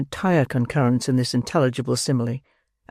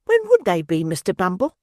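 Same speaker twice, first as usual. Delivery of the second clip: lightly voiced prim matron, higher; a touch of the genteel matron; 6 semitones higher than usual. A narrator's reading. Matron voice in read story.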